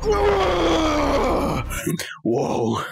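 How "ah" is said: high shouting cry. Acted. Comic cry.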